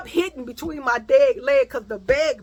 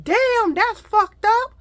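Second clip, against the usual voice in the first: high, loud shocked passengers; 9 semitones higher, 5 dB louder than usual. Retold or imitated. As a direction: gasp it out with concern. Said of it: "They was like".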